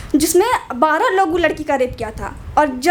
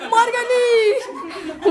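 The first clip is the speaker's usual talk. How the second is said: much higher, hammed-up cry of an auntie, the last word stretched out very slowly; mock auntie yelp; 5 semitones higher, much slower than usual. Auntie behind her on the bus crying out in a mock high yelp. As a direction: squeal it like a startled village auntie. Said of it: "चिल्ला के मेरा पर्दा फाड़ दी".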